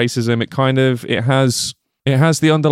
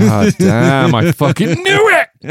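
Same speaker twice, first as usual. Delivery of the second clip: excited laughing shout; 9 semitones higher, 6 dB louder, slower than usual. Real, not acted. Mock outrage at a joke.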